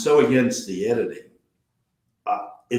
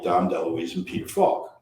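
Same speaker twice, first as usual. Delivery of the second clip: ordinary delivery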